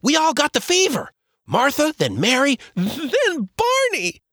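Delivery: put-on high, young boy, upset; a scared child blurting bad news; boy's voice. Joel tells his father the family got sick, high upset boy voice.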